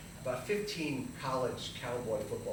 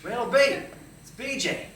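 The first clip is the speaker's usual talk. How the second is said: high, loud phone greeting; excited hail; 9 semitones higher, 10 dB louder than usual. Acted. Friend phoning an old pal, hollering the nickname. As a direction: call out the nickname heartily.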